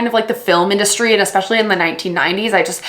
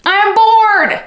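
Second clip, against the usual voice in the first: louder, higher whine of boredom; hammed-up bored wail; mock bored shout; 10 semitones higher, 4 dB louder, slower than usual. Reviewing a slow audiobook, shouting her boredom.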